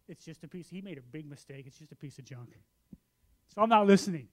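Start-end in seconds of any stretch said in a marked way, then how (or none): 0.1-2.5 much softer, under the breath; muttered aside. Retold or imitated. Thinking to himself while friend talks, muttered dismissively. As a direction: private dismissive thought, low in volume. Retold private dismissive thought.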